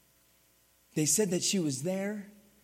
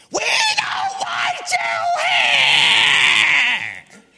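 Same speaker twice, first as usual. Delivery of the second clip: put-on much higher, louder, slow screaming old woman; crazed shout; 13 dB louder, much slower than usual. Ornery nursing home lady screaming at the young preacher, high shrill yell. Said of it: "this lady just starts going crazy".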